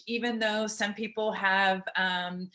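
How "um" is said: ordinary talk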